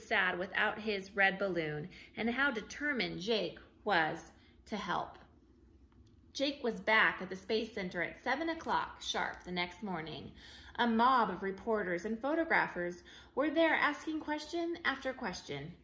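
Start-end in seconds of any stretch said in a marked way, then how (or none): none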